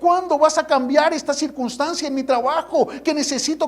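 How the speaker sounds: high-pitched insistent questioning; impatient plea to God. Acted. Believer listing requests to God, insistent pleading. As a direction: pile up each demand, voice held high. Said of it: "tú le haces a Dios la pregunta".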